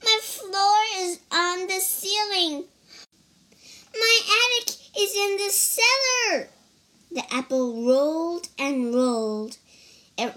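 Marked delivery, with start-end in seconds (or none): 0.0-6.5 child voicing a frantic little worm; worm crying out. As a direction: yell for help as a tiny panicked worm. Worm voice continues.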